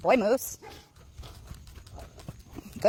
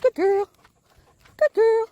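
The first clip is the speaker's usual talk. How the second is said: high-pitched praise; 8 semitones higher, 5 dB louder, slower than usual. Real, not acted.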